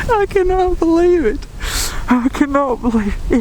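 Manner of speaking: high, elated disbelief; stunned hunter marvelling at his deer. Real, not acted. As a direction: let the amazement break through. Disbelief after the kill.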